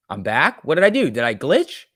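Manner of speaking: real surprise at a glitch; surprised. Real, not acted. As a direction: act confused about the glitch. Reacting to stream glitch.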